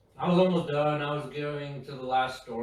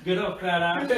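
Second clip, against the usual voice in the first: higher, louder taunt in a put-on gadget voice; mock watch insult; 5 semitones higher, 6 dB louder than usual. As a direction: jeer it like a snarky machine.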